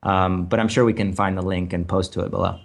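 normal talking voice

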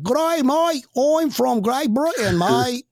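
put-on high, drawn-out Aussie-British accent parody; mock British-Australian accent. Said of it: "talking to a, somebody from London".

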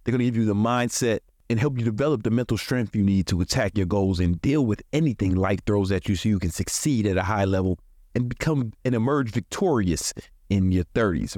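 usual speaking voice

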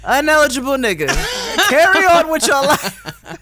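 loud, high mock send-off; dismissive. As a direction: wave them off loudly. Mocking dismissal.

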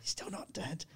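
whispered, imitating the woman; embarrassed whisper, re-enacted; hushed confession. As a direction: confide it in a whisper. Voicing the woman's awkward confession.

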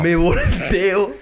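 very slow, drawn-out suspense. Real, not acted. Host slows down for the big reveal of mom. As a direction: draw it out to the reveal. Climax of caught story.

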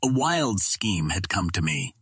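plain reading voice

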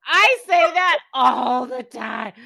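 loud, slow cry of being caught out; called out. Real, not acted. Host caught using the same word, loud slow dismay. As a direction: groan it out, caught red-handed. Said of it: "I feel so called out right now".

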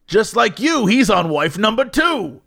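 radio ad pitchman. Retold or imitated. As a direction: punch it out like an insurance ad. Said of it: "ad ends with something like".

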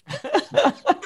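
a sudden high-pitched laugh; high burst of laughter. Real, not acted. Laughing at the sped-up part.

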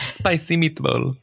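joking, slow mock-Italian accent; playfully drawn-out fake Italian accent; spaghetti Italian accent. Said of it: "more like a spaghetti Italian accent".

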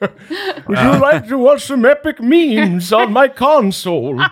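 put-on old man voice. Mimicking the old robot voice.

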